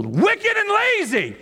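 very high, slow drawn-out "wicked and lazy"; preacher mocks the rebuke he dreads, squealing it high.

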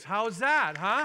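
loud, high challenge to the room; real preacher's rising glee; rousing. Real, not acted. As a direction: toss the question out to stir the crowd. Rousing congregation after good news.

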